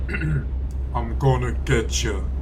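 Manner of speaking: slow, drawn-out mock villain voice; a clumsy go at a GTA thief, stretched out slowly; bad thief impression. Streamer does a chat request, a poorly faked slow GTA thief voice. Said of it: "a really bad one that I just did".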